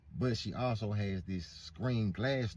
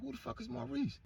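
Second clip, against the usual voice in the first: pitched-up outraged question; high disbelief; 5 dB quieter than usual. Retold or imitated. Learning she has a man, high outraged disbelief. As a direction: squeak the name in disbelief.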